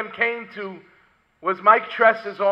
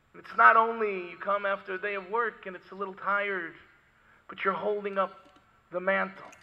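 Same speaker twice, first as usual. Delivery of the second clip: ordinary talk, nothing marked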